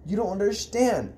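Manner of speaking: high, exasperated tirade; angry shouting. Acted. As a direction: keep the pitch up and insist on each point. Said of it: "I shouted".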